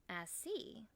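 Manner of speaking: soft, knowing Southern voice; honey-smooth Southern accent. A narrator's reading. Local woman catches on to the visitor, soft Southern accent. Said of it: "she says with a honey-smooth southern accent".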